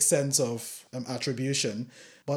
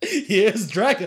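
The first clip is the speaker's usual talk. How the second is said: very slow, high, louder mock menace; Here's Johnny parody; 7 semitones higher, 7 dB louder, much slower than usual. Parodying the Shining line for Dolarhyde, mock menace. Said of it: "Here's Johnny".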